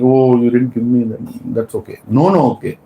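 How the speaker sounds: lightly acted humble acceptance; mock humble. Shown as a demonstration. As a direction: let it go meekly, as a mock example. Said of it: "humbly saying".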